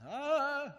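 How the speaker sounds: high, loud, drawn-out "ah"; imitated cry of alarm, held long; mock startled cry. Grandson imagining grandpa's reaction, high mock cry. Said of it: "make him go".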